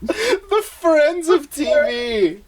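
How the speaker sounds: drawn out, high and teasing; slow mocking echo. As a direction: stretch it out to mock the line.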